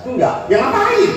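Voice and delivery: high-pitched mock comeback; retorting wife. Wife retorts to her husband about the sermon, mock indignant. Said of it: "Dan istrinya berkata".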